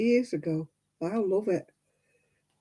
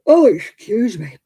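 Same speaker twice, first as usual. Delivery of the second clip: yawning; 5 semitones higher, 10 dB louder than usual. Real, not acted. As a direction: yawn your way through the apology. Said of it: "Blooming yawning".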